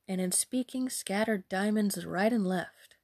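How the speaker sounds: usual speaking voice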